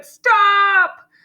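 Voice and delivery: high, loud shout; loud, high-pitched child's yell; yell as loud as possible. A narrator's reading. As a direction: yell it with all your might. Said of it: "yelled as loud as she could".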